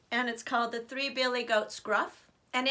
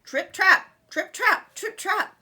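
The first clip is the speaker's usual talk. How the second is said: high, loud quick hoofbeats; high loud trip trap; 9 semitones higher, 10 dB louder, slower than usual. A narrator's reading. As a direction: clatter it high. Middle goat running away.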